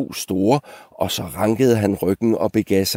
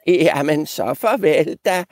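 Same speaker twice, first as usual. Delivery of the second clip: put-on high old-goat farewell; 8 semitones higher than usual. Old goat sending off the three, high teasing voice. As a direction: pitch it high, needling as you wave them off. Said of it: "sagde den gamle gedebuk".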